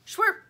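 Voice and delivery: high loud squirt noise; spray squirt. A narrator's reading. Lumpy squirting the kitten, sharp spray sound.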